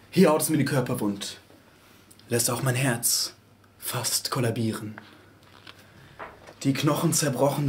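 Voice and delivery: dark subdued poetry reading; somber recitation. Acted. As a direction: recite it like someone dazed after the fall. Subdued aftermath of poem.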